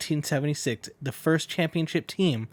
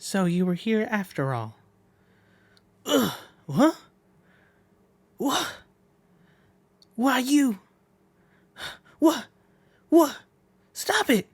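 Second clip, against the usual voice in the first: high, pleading cries; frightened pleading character; 12 semitones higher than usual. Acted. A scared boy begs the attackers to stop, high. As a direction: beg them to stop, high and desperate. New character confronted, begging.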